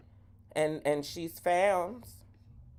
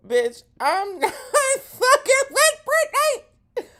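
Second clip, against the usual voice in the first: very high, loud, drawn-out squeal; squealed refusal; 8 dB louder, slower than usual. Real, not acted. Host reacting to Brittany's accent, loud high squealed refusal. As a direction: shriek it slowly through laughter.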